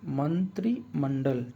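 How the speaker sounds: मंत्रिमंडल very slowly, "man-tri... man-dal"; sounding it out. Shown as a demonstration. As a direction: split मंत्रिमंडल into drawn-out parts. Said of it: "आपका क्या होगा?".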